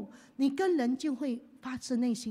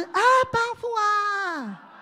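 slow, high, full-voiced shout of Abba Father; shouted prayer; 6 semitones higher, 7 dB louder, slower than usual. Shown as a demonstration. Preacher leads the church, shouting slowly. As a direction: cry it out loud and long. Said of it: "再一次".

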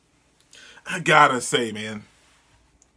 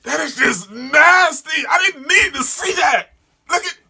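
loud, appalled exclamation; disgusted outburst; 11 semitones higher, 4 dB louder than usual. Real, not acted. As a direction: wail it in revulsion, pitch way up. Disgusted outburst at gory manga.

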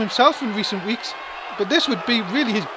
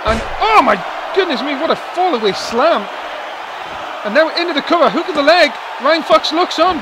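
louder, excited play-by-play call; wrestling commentator; 4 semitones higher, 4 dB louder than usual. Acted. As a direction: call the big slam with rising excitement. Wrestling match call heats up.

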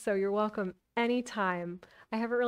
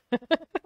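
laugh; 7 semitones higher, 6 dB louder than usual. Real, not acted. Laughs between story lines.

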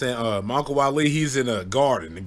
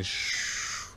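flying whoosh; 10 dB quieter than usual. Retold or imitated. Potato flying sound effect.

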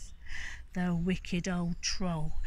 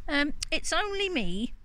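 timid goat; 6 semitones higher than usual. Acted. Small goat answering troll.

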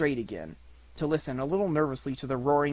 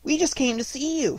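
high little-animal voice; Piglet; 11 semitones higher than usual. A narrator's reading. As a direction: pipe up high as a small piglet. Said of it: "said Piglet".

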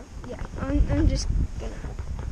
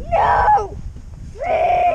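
high yelling in mock panic; startled shout; 11 semitones higher, 4 dB louder than usual. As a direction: cry out as if disaster struck. Pin lands, mock disaster.

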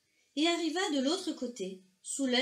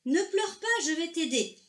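higher, louder, brisker comforting; eager reassurance; 6 semitones higher, 4 dB louder than usual. A narrator's reading. Argento comes closer and offers help, bright eager reassurance. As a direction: lift the voice and promise help. Fish approaches, promises help.

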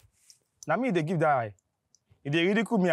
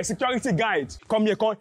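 ordinary delivery

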